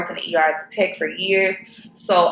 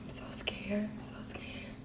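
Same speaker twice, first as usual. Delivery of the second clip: real whisper, voice gone from nerves; hushed and frightened before checking results; scared whisper; 15 dB quieter than usual. Real, not acted. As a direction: barely breathe the words out, terrified. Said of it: "I lost my voice".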